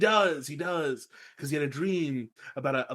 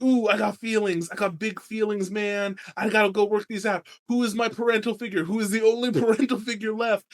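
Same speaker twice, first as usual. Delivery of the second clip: mock emotional Bran; 7 semitones higher than usual. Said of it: "he's like".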